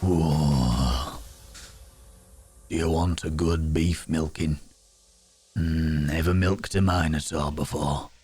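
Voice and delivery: put-on low, seductive Minotaur with Yorkshire accent. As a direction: play a lusty Yorkshire bull-man, deep down. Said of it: "I don't know why the Minotaur's from Yorkshire".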